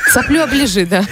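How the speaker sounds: сквозь смех, весело; смеясь, подначивает коллегу; со смехом. Real, not acted. Смеётся, шутит про остатки сгущёнки. (говорит сквозь смех)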